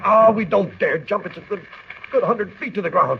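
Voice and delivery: panicked. Acted. Panicked at window.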